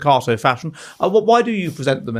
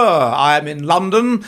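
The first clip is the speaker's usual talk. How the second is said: ordinary delivery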